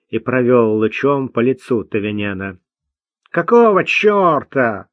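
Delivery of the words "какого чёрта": медленно, резко огрызнуться повыше; злобно огрызнуться на фонарь; огрызаясь. A narrator's reading. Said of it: "огрызнулся тот". (сердитый огрызающийся бродяга)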